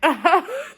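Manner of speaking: high squeaky laugh; short high giggle; burst of laughter. Real, not acted. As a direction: laugh in disbelief. Laughs at clip.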